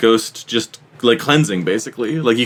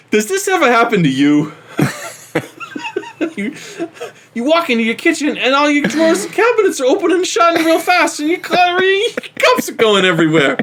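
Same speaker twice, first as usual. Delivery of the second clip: infomercial pitchman; 12 semitones higher than usual. Acted. Mock infomercial pitch for ghost product.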